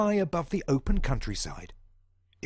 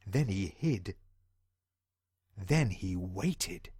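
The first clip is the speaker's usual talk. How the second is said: slow, soft and low; suspenseful pause; 8 semitones lower, 4 dB quieter, slower than usual. A narrator's reading. Narrator slowing down for suspense with children. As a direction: let it hang in suspense. Suspense before the burglar returns.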